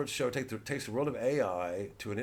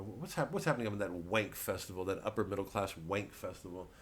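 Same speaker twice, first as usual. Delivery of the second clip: ordinary delivery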